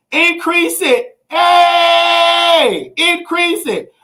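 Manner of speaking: hochgestimmtes Predigen mit lauten Rufen nach Lord; preaching prayer. Acted. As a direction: shout it out like a revival preacher. Leads prayer for increase.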